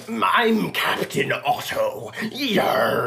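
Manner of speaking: slow, hammed-up pirate captain; sea captain. Co-host voicing Otto in his uniform, pirate captain. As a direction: draw it out like a cartoon sea captain. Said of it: "Otto's in a full captain's uniform".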